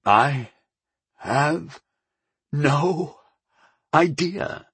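strained, word by word with long gaps; halting, effortful grunt. A narrator's reading. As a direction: force each word out through effort. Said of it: "I grunted".

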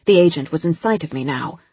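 ordinary delivery

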